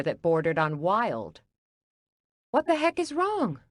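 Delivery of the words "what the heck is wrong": alarmed question. Acted. Rushing in on yelling.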